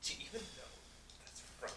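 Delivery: hushed teasing whisper. Pretend. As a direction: say it again under your breath. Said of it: "repeated as whisper".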